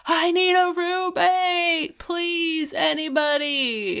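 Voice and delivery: high, drawn-out mock wail; mock scream. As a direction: imitate a desperate plea, stretching each word high. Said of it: "and screams".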